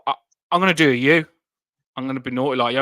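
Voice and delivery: usual speaking voice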